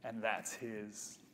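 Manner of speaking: quietly moved, on the edge of tears; tearing up. Real, not acted. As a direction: hold back real tears as you tell it. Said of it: "sorry, I'm tearing up".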